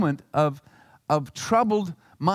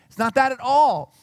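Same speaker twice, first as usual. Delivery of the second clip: emphatic denial; 7 semitones higher, 6 dB louder than usual. Real, not acted. Emphatic correction in sermon.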